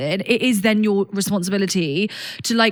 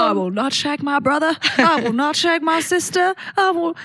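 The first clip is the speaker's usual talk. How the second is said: mock pledge recital; 6 semitones higher than usual. Mock chant of rules.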